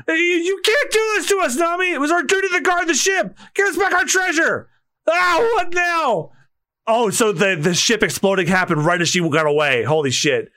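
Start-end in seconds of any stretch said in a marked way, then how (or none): 0.1-6.3 high, distraught character voice; panicked crewman. Acted. Crewman begging the departing thief for the treasure, panicked. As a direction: plead after the thief in a high wail. Crew pleads as thief sails off.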